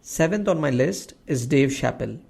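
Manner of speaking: ordinary talk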